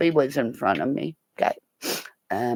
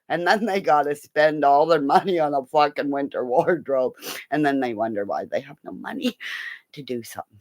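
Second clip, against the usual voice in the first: ordinary talk, nothing marked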